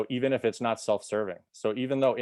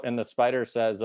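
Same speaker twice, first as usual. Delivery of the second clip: ordinary talk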